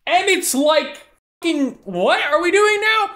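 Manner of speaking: high-pitched mock exasperation; exasperated bafflement. Retelling the onlookers' reaction to Kaz, high and exasperated. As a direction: squeal it in disbelief.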